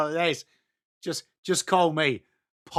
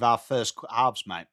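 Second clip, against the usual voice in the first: ordinary talk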